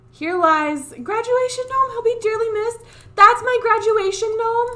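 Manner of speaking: playful high-pitched mock grief; mock mourning. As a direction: lament the gnome in a high squeaky voice. Mock eulogy for gnome.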